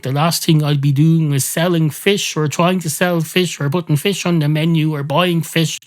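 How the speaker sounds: worked-up comic tirade; incredulous rant. Acted. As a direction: rant in disbelief, building on and on. Mock rant about ordering fish.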